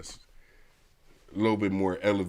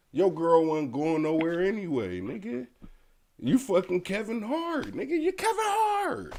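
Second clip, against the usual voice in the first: heated rant; 10 semitones higher, 3 dB louder than usual. Real, not acted. Ranting at Kevin Hart.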